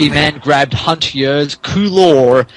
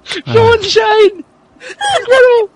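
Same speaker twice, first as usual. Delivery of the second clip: shrieking through laughter, way up high; laughing outburst; 4 dB louder than usual. Real, not acted. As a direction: cry out the name while cracking up. Laughing fit over silly name.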